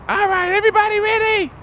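very high squeaky bug voice; ant leader voice. Retold or imitated. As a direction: call the swarm to bite in a tiny squeaky voice. Ants voiced ready to bite.

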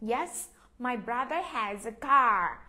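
slow, careful "my brother has a car"; slow model reading. Shown as a demonstration. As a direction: read it slowly for pupils to repeat. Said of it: "Mình nhấn mạnh chỗ cái chữ".

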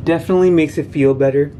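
lips held off teeth; careful stiff mouth speech; whitening strips on teeth. Real, not acted. Seen 0: Strips applied at -5, teeth coated throughout, shown again +9.9.

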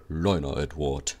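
low, condescending big-engine voice; deep, self-important voice; big proud engine. Acted. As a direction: talk down to the little one. Big proud Gordon talking down.

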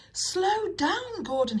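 high-pitched storybook shout of warning; urgent warning call. A narrator's reading. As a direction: call out to halt a speeding engine. Driver calls warning to engine.

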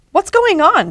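high, mildly shouted question; storybook shout. A narrator's reading. David to Pete running off with the ball, high mild shout. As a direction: pitch it up as a startled call. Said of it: "shouted David".

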